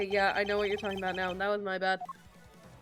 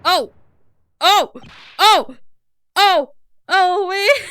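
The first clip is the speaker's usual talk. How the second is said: alarmed; 9 semitones higher, 13 dB louder, slower than usual. Real, not acted. Partner runs at armed suspect.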